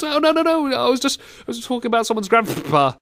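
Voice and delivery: high-pitched, squirming mock apology; flustered excuse. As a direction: plead innocence to the boss. Acting out excuse to boss.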